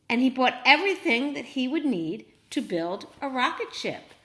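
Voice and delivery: plain reading voice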